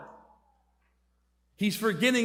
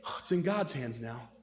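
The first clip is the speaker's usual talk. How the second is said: low, hushed defeat; mock-solemn quiet surrender; doctor's resignation; 7 semitones lower, 7 dB quieter than usual. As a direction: imitate a TV doctor softly giving up. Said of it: "what's the last thing they say".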